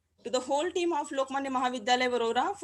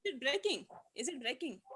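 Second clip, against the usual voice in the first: barely audible; 9 dB quieter than usual. Real, not acted. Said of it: "It is low at your end".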